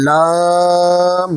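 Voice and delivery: লাম held long in recitation; লাম sustained like Quran reading; recited Lam. Shown as a demonstration. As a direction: chant লাম slowly for the class. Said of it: "লামের মাখরাজ".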